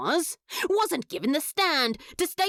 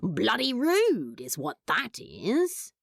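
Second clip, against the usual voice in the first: a lightly lowered, sulky demon voice; Crowley, sullen then brightening; 8 semitones lower than usual. Acted. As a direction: grumble the complaint, then cheer up a little. Crowley voiced in fic reading.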